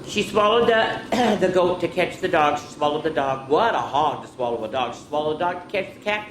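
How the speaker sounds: lively storybook read-aloud; reading to children. Acted. Teacher reading a picture book to children, lively. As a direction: read it out to the kids with bounce.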